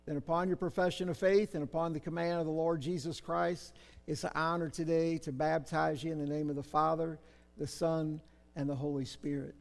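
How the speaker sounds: ordinary talk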